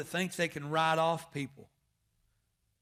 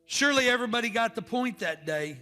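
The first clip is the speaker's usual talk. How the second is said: loud, high, slowed preaching over music; preaching the close over the organ; full-force preaching; 8 semitones higher, 9 dB louder than usual. Acted. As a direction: press the question on the congregation. Altar call with music.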